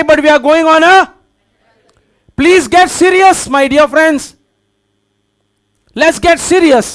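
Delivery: urgent, emphatic preacher delivery; forceful preaching. Real, not acted. Preacher to congregation about praying mothers on a battlefield, forceful preaching.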